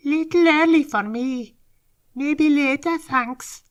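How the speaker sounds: sly pleased little creature. Acted. Brownstone declines politely.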